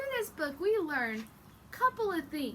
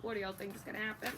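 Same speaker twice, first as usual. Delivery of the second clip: normal talking voice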